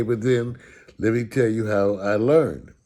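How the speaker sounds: ordinary talk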